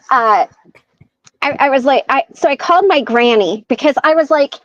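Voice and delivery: usual speaking voice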